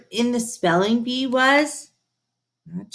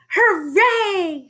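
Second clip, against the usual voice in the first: higher joyous hooray; cried hooray; 12 semitones higher, 4 dB louder than usual. A narrator's reading. Tina waking up normal again, cheering higher. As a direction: cheer with delight. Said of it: "she cried".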